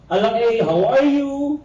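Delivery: a Batangas blonde's bright English greeting; Batangas accent. Retold or imitated. Batangas local greeting students in a Batangas accent. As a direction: mimic a Batangas punto saying hello. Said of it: "ang sabi sa'yo".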